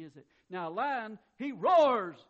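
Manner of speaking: ordinary talk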